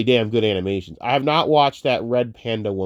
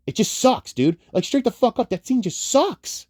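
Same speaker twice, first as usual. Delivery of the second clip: rising exasperated rant; 12 semitones higher, 3 dB louder than usual. Real, not acted. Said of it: "rant rises in pitch".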